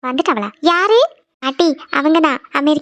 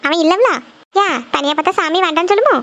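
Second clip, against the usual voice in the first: usual speaking voice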